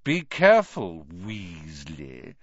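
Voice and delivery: sly mocking threat; sneered. A narrator's reading. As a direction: sneer the warning, dropping on the surname. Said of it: "sneered Malfoy".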